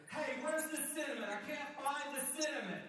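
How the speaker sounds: high, loud call from another room. Acted. Housemate shouting from the kitchen, loud and high. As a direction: yell for the missing cinnamon. Housemate calls again, louder.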